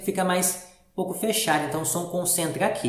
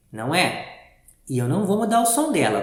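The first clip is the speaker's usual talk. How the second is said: usual speaking voice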